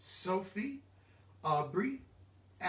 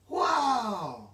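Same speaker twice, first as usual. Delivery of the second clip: loud delighted wow; amazed; 4 semitones higher, 7 dB louder than usual. Acted. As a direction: marvel out loud. Marvelling at hippo speed.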